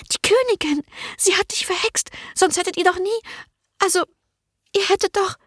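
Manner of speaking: hohe, gehetzte Stimme einer verängstigten jungen Dienerin; drängend, voller Angst, hoch gesprochen; ängstliche Magd. A narrator's reading. Merle voiced high. (verängstigte junge Dienerin, drängend)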